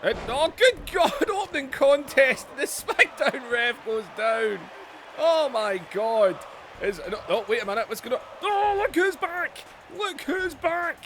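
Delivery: high, loud, breathless wrestling commentary; excited play-by-play. Acted. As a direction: call the match like every move is a shock.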